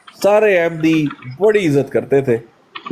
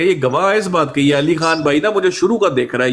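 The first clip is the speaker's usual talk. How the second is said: ordinary delivery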